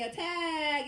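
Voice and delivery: loud, high mock battle order; general's command. General orders Gen Z troops forward, loud high mock shout. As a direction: holler it like a charge order. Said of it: "The general will say".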